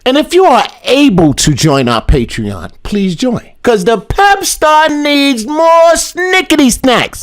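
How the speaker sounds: loud hyped outro read. Acted. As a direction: pitch the subscribe plea hard.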